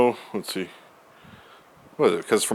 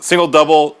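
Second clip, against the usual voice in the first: excited build; 6 semitones higher, 6 dB louder than usual. Retold or imitated. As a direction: punch each word in a row. Retelling a Tetris streak.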